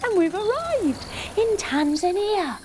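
high, thrilled arrival; excited announcement. Acted. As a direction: announce it like a big surprise. Arriving on the pretend trip.

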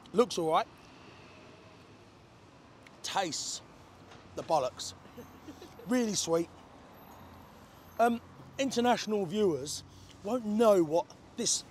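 just drank tea; talking after a sip. Real, not acted. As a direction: cup in hand, speaking. Seen 1: Sips at -3 to -1; talks holding cup, mouth clear.

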